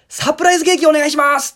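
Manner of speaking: high, loud and fast order; eager loud request. Retold or imitated. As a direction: blurt out the surprise to the staff. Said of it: "そしたらね、お父さんが、". (大声で早口に頼む)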